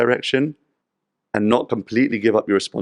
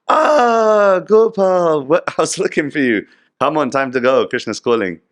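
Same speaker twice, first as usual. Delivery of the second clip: much higher, louder, laughing delight; delighted Narad Muni; 7 semitones higher, 6 dB louder than usual. Retold or imitated. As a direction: greet the dog with joy. Narad finds the dog.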